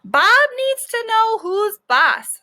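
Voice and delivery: high, slow, emphatic teacher voice; stern dog-class teacher; bossy teacher. Acted. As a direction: lay down the law slowly. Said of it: "said the teacher".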